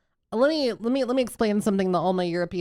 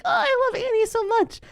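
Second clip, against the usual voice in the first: gushing fan. Retold or imitated. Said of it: "they're like".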